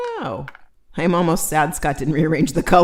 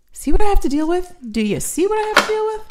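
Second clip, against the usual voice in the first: mock exasperated; 11 semitones higher, 5 dB louder, faster than usual. Mock complaint to audience.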